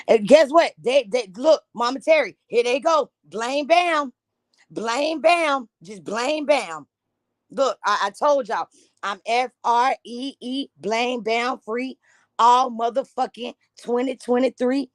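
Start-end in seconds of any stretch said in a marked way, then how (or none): none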